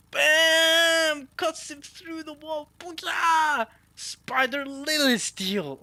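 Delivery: high, drawn-out battle yell; attack cry. Acted. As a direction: shout the attack name at full blast. Shouts out attack in manga.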